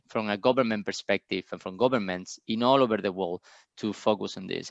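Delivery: usual speaking voice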